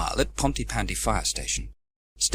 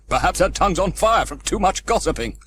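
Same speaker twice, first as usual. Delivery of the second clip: chuckling; 7 semitones higher, 6 dB louder than usual. Acted. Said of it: "chuckled Steele".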